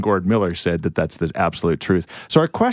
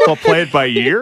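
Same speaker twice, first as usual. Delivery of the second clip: louder, higher, laughing through "We'll play it by year?"; laughing disbelief; 7 semitones higher, 4 dB louder than usual. Real, not acted. Host laughing at co-host's slip, loud and amused. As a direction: laugh at the mistake. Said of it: "I don't know".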